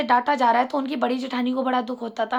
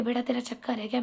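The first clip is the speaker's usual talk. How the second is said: softly asking, much quieter, almost secret; hushed and gentle; 3 semitones lower, 8 dB quieter than usual. Retold or imitated. Aunt secretly asking the girl, hushed and gentle. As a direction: lean in and ask kindly under your breath. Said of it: "इशारे में पूछा प्यार से".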